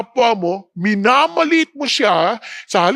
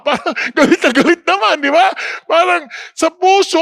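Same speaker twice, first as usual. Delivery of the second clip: laughing; 6 semitones higher, faster than usual. Real, not acted. Preacher mocks the hypocrite.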